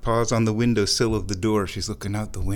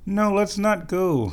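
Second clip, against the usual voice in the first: playful high refusal; 9 semitones higher than usual. Real, not acted. Refusing the cat.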